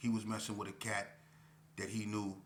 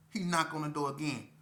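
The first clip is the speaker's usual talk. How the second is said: ordinary delivery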